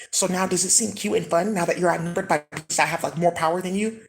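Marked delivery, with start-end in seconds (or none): none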